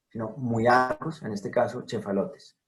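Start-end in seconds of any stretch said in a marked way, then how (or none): 0.1-1.2 muy arcus said with u and i glided together; teacher repeating the correct muy arcus; correct ui sound. Shown as a demonstration. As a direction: say muy arcus, not miarcus. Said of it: "Lo correcto es pronunciar ui".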